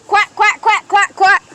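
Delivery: put-on loud quacks for the other kid to copy; loud duck quacks. Shown as a demonstration. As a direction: demonstrate a loud duck call.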